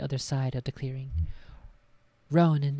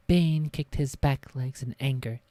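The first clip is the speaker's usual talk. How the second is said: ordinary delivery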